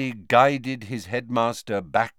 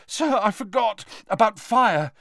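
high, fast, breathless panic; panicked, panting; 10 semitones higher than usual. A narrator's reading. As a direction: blurt it out frightened. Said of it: "panted Harry".

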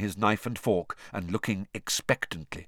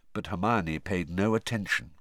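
plain reading voice

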